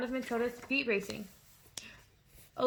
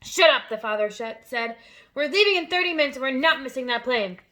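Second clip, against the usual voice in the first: angry shout; 2 semitones higher, 7 dB louder than usual. A narrator's reading. Father silencing Matilda.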